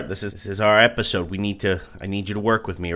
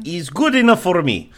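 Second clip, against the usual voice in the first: put-on Russian accent; 6 semitones higher than usual. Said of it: "accent heavy episode".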